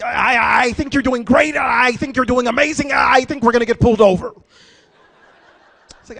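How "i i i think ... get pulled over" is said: loud, stammering, indecisive judge; Howie Mandel. Acted. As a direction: shout hesitant encouragement, stuck on the first word. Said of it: "imagine him trying to direct you".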